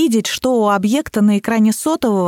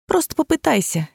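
higher, persistent coaxing; insistent; 7 semitones higher than usual. A narrator's reading. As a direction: nag lightly in a lifted voice. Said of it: "не отставала я".

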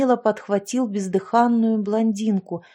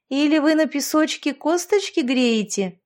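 higher, sneering teasing voice; mocking; 5 semitones higher than usual. A narrator's reading. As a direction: taunt the older women with a sneer. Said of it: "насмешливо посмотрела".

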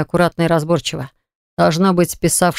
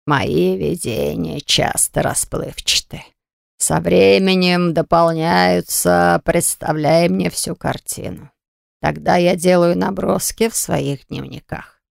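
put-on old man voice; slower than usual. Old oracle explains.

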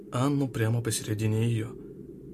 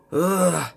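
loud, higher cry of pain; pained yell; 7 semitones higher, 8 dB louder than usual. A narrator's reading. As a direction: cry out as the wrist is cut. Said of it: "заорал командир эскорта".

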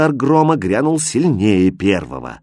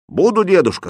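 higher-pitched, firm promise; eager resolve; 9 semitones higher, 4 dB louder than usual. A narrator's reading. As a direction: answer at once, full of resolve. Hero promises the old man.

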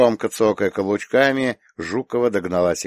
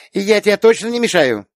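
put-on high, louder child's voice asking sweetly; small girl checking politely, voice pitched high; polite little girl; 13 semitones higher, 6 dB louder than usual. A narrator's reading. Little girl asks Aunt Rita politely if she's in the way, courteous. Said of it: "любезно поинтересовалась".

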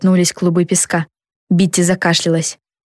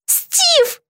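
high, grieving moan of a name; anguished moan. A narrator's reading. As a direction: moan for a hurt friend, high and falling. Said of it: "простонала Битти". (горестный стон высоким голосом)

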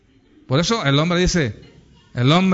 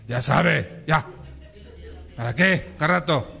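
lightly voiced grumpy husband, lower; dismissive husband; 3 semitones lower than usual. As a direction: brush off the advice. Reluctant husband answers the adviser. (marido desganado, un poco más grave)

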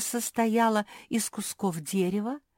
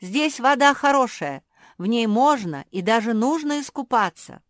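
calling out from shore; 4 semitones higher, 8 dB louder than usual. A narrator's reading. Teo calls from shore.

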